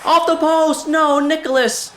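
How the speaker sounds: very high, loud cry at the near miss; agonized outburst; anguished shout. Real, not acted. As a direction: yell in disbelief. Near goal hits post.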